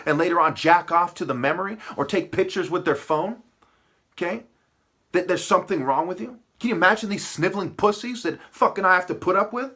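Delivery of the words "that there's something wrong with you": usual speaking voice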